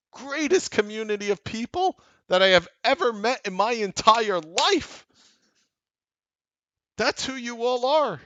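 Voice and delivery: real passionate yelling to the viewers; fired-up shout. Real, not acted. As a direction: hype the community up at the top of your voice. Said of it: "it sounds crazy to talk like that".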